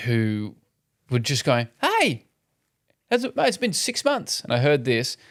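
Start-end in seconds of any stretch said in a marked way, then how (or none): 1.8-4.4 high, cheery bloke's hello; mate greeting. Retold or imitated. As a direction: greet your mate brightly, much higher. Two blokes greeting in the aisle.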